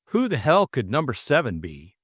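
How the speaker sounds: plain reading voice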